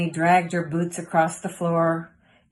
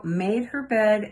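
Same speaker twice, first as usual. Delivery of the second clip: usual speaking voice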